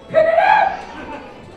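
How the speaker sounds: high loud shout; loud high call to pick it up. Real, not acted. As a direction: call out sharply. Prop dropped on stage.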